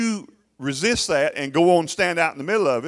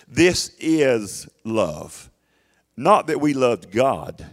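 slow emphatic reading; 3 semitones lower, much slower than usual. Shown as a demonstration. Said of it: "reads the verse slowly".

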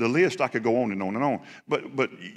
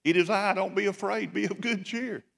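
high, soft voice; gently hushing frightened friends, pitched high; soft, high-pitched mock reassurance; Jesus reassuring the disciples; 8 semitones higher, 4 dB quieter than usual. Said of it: "Jesus is like".

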